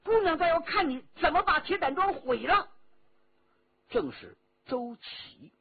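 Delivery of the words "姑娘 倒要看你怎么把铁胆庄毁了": high, loud angry challenge; fierce high-pitched defiance; stern shout. A narrator's reading. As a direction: burst in with a sharp, raised voice. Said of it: "厉声说道".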